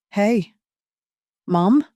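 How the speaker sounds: slurring a little, too friendly; syrupy and thick. A narrator's reading. Tipsy son greeting his mother, syrupy and thick. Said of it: "his voice syrupy and thick".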